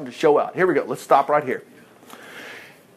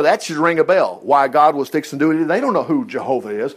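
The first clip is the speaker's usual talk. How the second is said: normal talking voice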